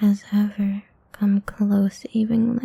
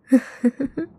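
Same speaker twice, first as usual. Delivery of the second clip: playful predatory giggle; 4 semitones higher than usual. Acted. Vampire laughs as prey flees.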